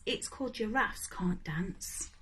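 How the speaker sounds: usual speaking voice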